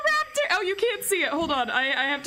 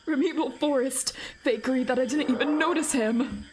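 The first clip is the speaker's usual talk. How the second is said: ordinary talk, nothing marked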